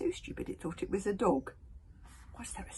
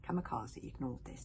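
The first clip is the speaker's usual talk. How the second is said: plain reading voice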